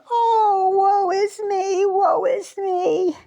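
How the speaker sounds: put-on high, drawn-out crying wail of a self-pitying old woman; wailing old lady. A narrator's reading. Old lady wails her misery to the fairy, high sobbing. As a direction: sob it out slowly like a whiny old lady. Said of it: "the little old lady again was crying".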